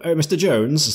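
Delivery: sudden loud hail; calling out. Acted. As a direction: call out loud to get his attention. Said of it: "I'm gonna go".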